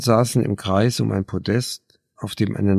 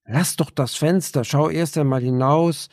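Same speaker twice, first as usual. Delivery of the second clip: urgent, higher demand; 7 semitones higher than usual. A narrator's reading. Clara urging Anne to look outside, urgent. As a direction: push her to look out. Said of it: "forderte Clara".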